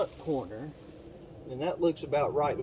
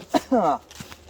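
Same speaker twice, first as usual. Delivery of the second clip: loud coughing fit; hacking to clear the throat; just swallowed a bug; 2 semitones higher, 6 dB louder than usual. Real, not acted. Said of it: "I swallowed a bug".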